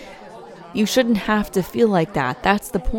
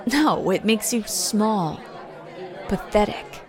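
contempt; 4 dB quieter than usual. Acted. Contempt toward bully.

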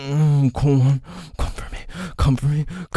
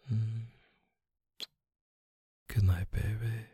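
soft tender; 5 dB quieter than usual. Real, not acted. Soothing partner to sleep.

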